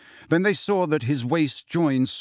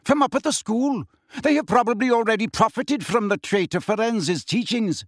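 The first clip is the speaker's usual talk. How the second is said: high, heated scorn; disdainful, agitated centaur; contemptuous; 9 semitones higher than usual. A narrator's reading. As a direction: spit out the accusation in a raised pitch. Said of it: "interrupted Bane contemptuously".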